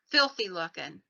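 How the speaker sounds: plain reading voice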